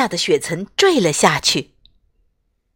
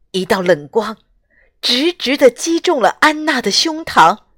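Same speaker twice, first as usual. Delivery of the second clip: plain reading voice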